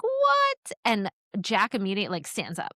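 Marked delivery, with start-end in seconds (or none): 0.0-0.7 shocked. Retold or imitated. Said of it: "And everyone's like".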